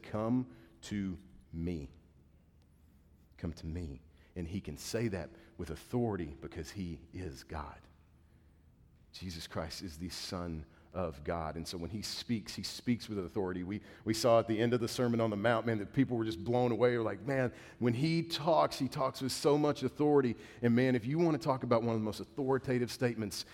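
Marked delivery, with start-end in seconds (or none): 0.0-4.0 slow, soft, gentle call; tender invitation. Acted. 17.3-20.3 awed crowd. Retold or imitated. Crowd amazed at Jesus.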